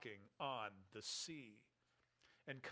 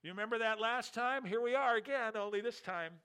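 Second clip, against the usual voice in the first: louder, higher anxious chatter; rising alarm among the rowers; anxious disciples; 8 semitones higher, 8 dB louder than usual. Retold or imitated. Frightened disciples in the boat, anxious and louder.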